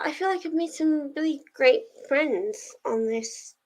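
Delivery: ordinary talk, nothing marked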